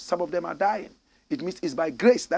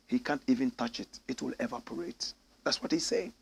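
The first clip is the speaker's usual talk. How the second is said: ordinary delivery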